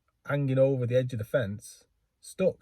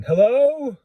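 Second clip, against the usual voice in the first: loud, high-pitched call across a field; long drawn-out shouted hello; shouting hello; 10 dB louder, much slower than usual. Retold or imitated. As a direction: holler for someone far off. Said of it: "We start shouting".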